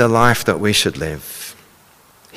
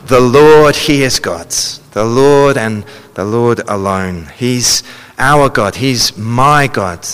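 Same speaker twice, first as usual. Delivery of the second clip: impassioned reading; 3 semitones higher, 3 dB louder than usual. Acted. Emphatic voicing of God's longing.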